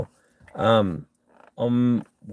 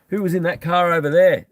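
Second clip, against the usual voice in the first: mimicking a cop; 8 semitones higher, 4 dB louder than usual. Mimicking police questioning.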